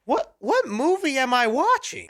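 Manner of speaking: high-pitched, incredulous question; stammered, high "what" of disbelief; baffled disbelief. Acted. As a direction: react in mock bewilderment, pitch shooting up. Acts out bafflement at the film.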